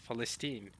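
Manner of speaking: Palestine said with F for P; Arabic pronunciation. Shown as a demonstration. Streamer repeating Arabic Palestine as "Falesteen", with F. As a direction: say Palestine as fa-les-TEEN. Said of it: "right, with an F".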